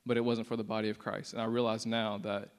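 ordinary talk, nothing marked

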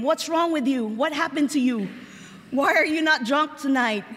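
ordinary delivery

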